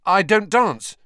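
high, loud blurted refusal; quick protest. A narrator's reading. Embarrassed student refusing the professor, hasty. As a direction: blurt it out to get out of it. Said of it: "he said quickly".

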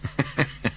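soft high chuckle over the phone; short laugh relayed through a speaker; quiet Southern caller's chuckle; tinny speakerphone laugh. A narrator's reading. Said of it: "The tinny speakerphone relayed a laugh".

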